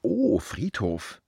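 slow, drawn-out imitation of an uneasy "Oh, Friedhof"; awkward mock reaction. Mimicking people who hear he works at the cemetery, slow and uneasy. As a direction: drag it out like a stranger lost for words.

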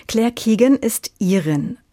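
ordinary talk

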